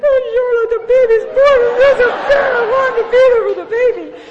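high, loud, self-pitying wail; pitiful whine. Retold or imitated. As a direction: play the victim to get sympathy. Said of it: "so now I do the other tactic".

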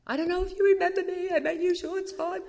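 high, fast, timid little voice; nervous caller. Retold or imitated. Calling Val, unsure she remembers, nervous high voice. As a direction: rush it out high and scared, unsure she'll remember.

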